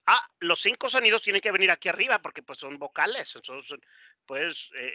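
ordinary talk, nothing marked

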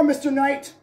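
normal talking voice

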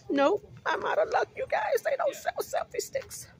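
high, squeaky laughing disappointment; amused letdown. Real, not acted. Vlogger telling viewers the store has no selfie sticks, high and amused.

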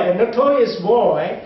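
ordinary delivery

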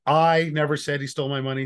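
"I" held long and leaned on; stress on I. Shown as a demonstration. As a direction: make "I" the word that counts. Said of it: "depending on which word you emphasize".

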